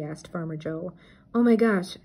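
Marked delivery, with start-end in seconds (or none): 1.3-2.0 loud, higher panicked cry; suddenly remember, in a panic; alarmed cry. A narrator's reading. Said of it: "Shaggy cried".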